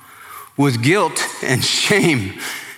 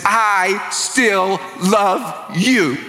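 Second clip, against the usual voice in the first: called out; 4 semitones higher, much slower than usual. Acted. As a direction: call it out slowly, stretched and high. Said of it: "he yells your name and says".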